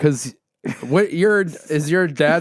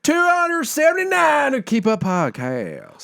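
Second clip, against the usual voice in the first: put-on Southern accent. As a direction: play up a big Southern twang on the show's intro. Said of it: "do this whole podcast with, with this accent".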